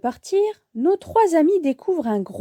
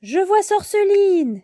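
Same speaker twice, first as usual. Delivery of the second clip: exclaiming; 7 semitones higher than usual. A narrator's reading. Bibou spots Sorceline with owl vision, exclaiming. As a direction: call out a power, then the discovery. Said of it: "s'exclame Bibou".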